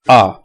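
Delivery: A said as a short open "ah"; hamza with fatha. Shown as a demonstration. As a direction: say A as a lone "ah" sound. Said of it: "It sounds A".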